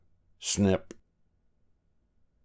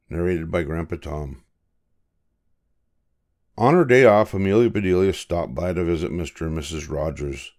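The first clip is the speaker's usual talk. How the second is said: ordinary delivery, nothing marked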